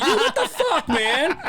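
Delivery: high, loud comic outrage; hammed-up aggrieved husband; mock jealous protest. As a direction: yell it in exaggerated indignation. Acted jealous protest at bird's treatment.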